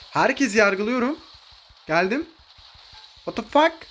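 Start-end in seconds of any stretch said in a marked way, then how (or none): 3.2-3.9 sharp high what the fuck; jolted by a surprise play; shocked outburst. Real, not acted. Streamer shocked by an enemy in a new skin.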